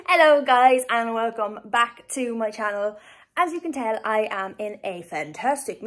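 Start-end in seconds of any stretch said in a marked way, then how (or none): none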